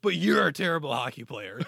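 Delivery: mock insult shout. Player insulting a rival, mock shout with laughter. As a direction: fire back the insult. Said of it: "like, he accepts it".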